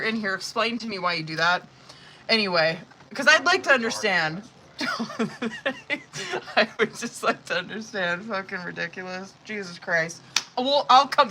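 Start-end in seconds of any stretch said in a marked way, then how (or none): 4.8-6.5 a soft, high giggle that breaks out; high amused laugh. Real, not acted. Reader to her audience, a soft high giggle. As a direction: laugh quietly at how ridiculous it is.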